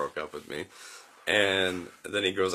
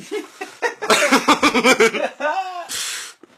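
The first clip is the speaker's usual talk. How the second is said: long loud high laughing fit; 10 dB louder than usual. Real, not acted. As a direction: burst out laughing. Recalling stoned moment.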